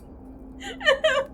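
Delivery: very high laughing whimper; squealing laugh-cry. Real, not acted. Player laugh-crying at enemies. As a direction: squeal between laugh and sob.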